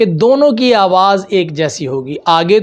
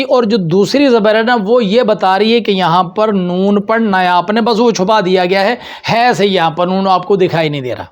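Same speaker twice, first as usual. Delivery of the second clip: normal talking voice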